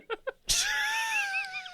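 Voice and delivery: shrieking laugh. Pretend. Shouted laugh after war cry.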